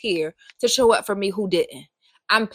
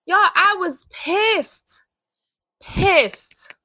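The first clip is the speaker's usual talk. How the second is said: higher, slow and seething; still pissed; 8 semitones higher, much slower than usual. Real, not acted. Telling viewers how angry God made her, a slow, higher, seething complaint. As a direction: drag the anger out, still burning. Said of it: "it probably sound like I still am".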